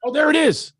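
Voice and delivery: high, loud sudden delight; found it. Real, not acted. Spotting his lost trophy on the shelf, delighted. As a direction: pops up when he spots it.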